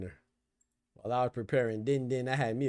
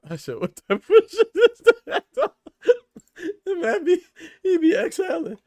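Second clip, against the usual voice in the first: high, loud, laughing through words; cracking up mid-sentence; laughing while talking; 11 dB louder than usual. Real, not acted.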